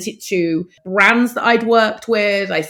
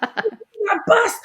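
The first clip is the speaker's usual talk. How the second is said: high, thrilled cry of discovery; excited squeal. Retold or imitated. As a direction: squeal it like you've just found treasure. Spots the motorhome, swerves off.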